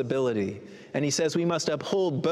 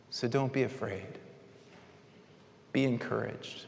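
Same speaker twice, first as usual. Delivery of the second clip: soft reassurance; 4 semitones lower, 6 dB quieter than usual. Real, not acted. Closing comfort to listeners.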